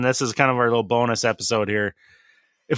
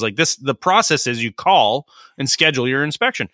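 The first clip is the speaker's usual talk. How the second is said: usual speaking voice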